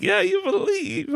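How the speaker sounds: high, slow egging-on; mock fervor. Dad eggs on his believing son, high mock fervor. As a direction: play along with exaggerated high-pitched belief.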